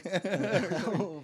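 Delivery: laughing while repeating a friend's punchline. Real, not acted. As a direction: laugh it out, delighted. Echoing the punchline, cracking up.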